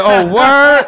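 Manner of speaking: loud, high mock-excited mom; losing it. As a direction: blurt it out, thrilled and high. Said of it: "she lose her shit".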